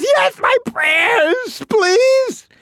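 very high, drawn-out desperate squeal; pleading chipmunk. Acted. Chipmunk begs Bear for last prayers, desperate squeal. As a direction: squeal the plea like a tiny creature.

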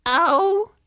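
put-on loud, high old-woman croak. A narrator's reading. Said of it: "she croaked".

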